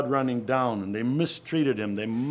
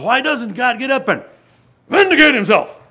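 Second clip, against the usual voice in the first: high, louder mock-indignant demand; indignant question; 12 semitones higher, 10 dB louder than usual. Brethren asking why God stays silent, mock indignant. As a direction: ask it as if outraged at God's silence. Brethren ask about vindication.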